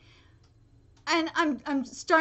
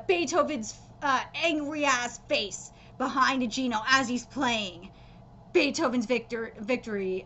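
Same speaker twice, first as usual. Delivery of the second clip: ordinary talk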